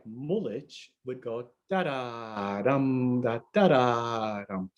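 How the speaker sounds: mullich sung out slowly, da da then a longer dum; slow rhythm syllables landing on the last dum; unstressed syllable rhythm. Shown as a demonstration. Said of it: "if we had a word that was not stressed".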